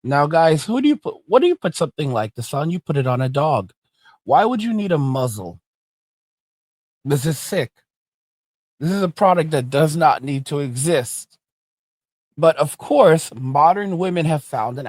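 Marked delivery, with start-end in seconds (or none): none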